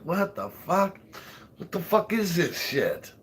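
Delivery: a hint of a baffled husband spitting out bad food; imitating the husband. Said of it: "And he's like".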